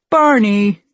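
punched-up cartoon dad line; Homer. A narrator's reading. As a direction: blurt the name out as Homer. Voicing Homer's annoyed line.

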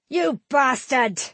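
high, slow furious shout. A narrator's reading. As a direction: hurl the curse at him. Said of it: "Tannic shouted".

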